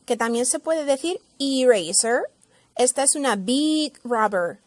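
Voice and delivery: ordinary delivery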